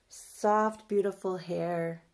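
ordinary talk, nothing marked

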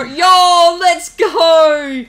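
loud, high victory cheer; triumphant shout. Real, not acted. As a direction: yell it out in triumph. Celebrating win.

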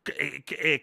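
usual speaking voice

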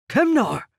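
high, loud call of the name; sharp rebuke. A narrator's reading. Captain stopping the interrogator from hurting the prisoner, sharp call. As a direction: snap his name to stop him.

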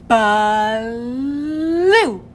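"Blue" loud, with the L held long; slow exaggerated speech modeling. Shown as a demonstration. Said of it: "One more time".

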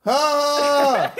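high startled yelp; sudden scream. Acted. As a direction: let out a short yelp. [name] screams as a bit.

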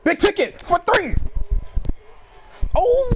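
high loud call; hyped shout at the game; excited cheer. Real, not acted. Cheering a three pointer.

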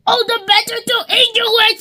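high, fast snarl of a wolf. Acted. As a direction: snap it out as the wolf. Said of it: "snarled the wolf".

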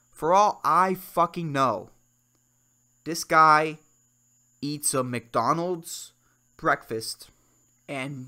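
normal talking voice

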